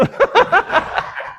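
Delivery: high airy laugh at his own joke; a quick silent wheeze of laughter; breathy unvoiced laugh. Real, not acted. Preacher laughing at his own joke about God, unvoiced laugh.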